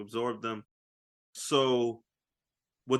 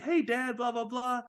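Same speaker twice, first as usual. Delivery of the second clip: put-on a little higher, childlike voice; a hint of a young boy talking to dad; lightly voiced kid; 11 semitones higher than usual. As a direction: play the young son calling his dad. Voicing the young son.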